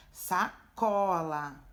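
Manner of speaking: sacola said slowly, the syllable có stretched and stressed; sa-CO-la, lean on the có. Shown as a demonstration. Teacher showing pupils the stressed syllable, slow sacola with có stressed. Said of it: "é o có que tem mais intensidade".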